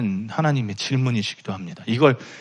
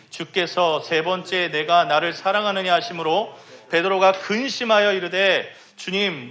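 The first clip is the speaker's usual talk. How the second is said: scripture reading; 7 semitones higher than usual. Acted. Reads John 21 aloud.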